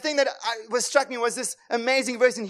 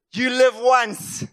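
normal talking voice

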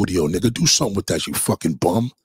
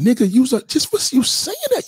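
incredulous outburst. Real, not acted. Ranting at a commenter, jumps up high in disbelief. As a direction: squeal it out in disbelief.

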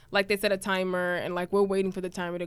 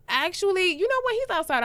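high, acted polite excuse; evasive backpedal; 10 semitones higher, 4 dB louder than usual. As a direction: back out of it in a high voice. Hastily dodging the request.

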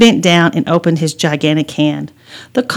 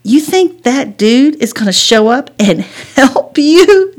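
high-pitched mocking question; teasing; 6 semitones higher, 4 dB louder than usual. A narrator's reading. Brother doubting Bigfoot will help, teasing. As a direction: tease with a scoffing laugh. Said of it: "Jackson teased".

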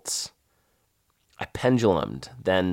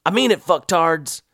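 louder barking sergeant voice; gruff sergeant; 7 semitones higher, 5 dB louder than usual. Retold or imitated. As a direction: growl it at the recruits. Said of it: "Tubby continued".

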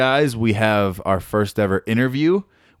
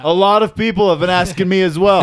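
mocking echo; 8 semitones higher, 5 dB louder than usual. Mocking echo of Big Wang.

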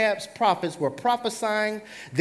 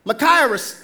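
normal talking voice

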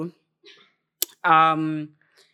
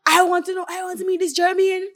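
imitating voice-note man; 9 semitones higher than usual. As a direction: proclaim it high, like the man on the recording. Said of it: "the man in the voice note said".

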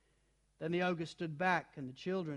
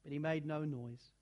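ordinary delivery

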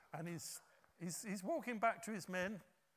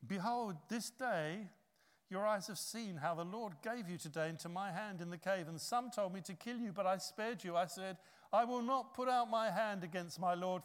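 proclaiming; 3 semitones higher, 6 dB louder than usual. Retold or imitated. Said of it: "he says this".

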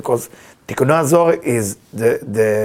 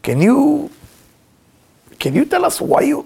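curious student; 13 semitones higher than usual. Retold or imitated. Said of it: "asked him one time".

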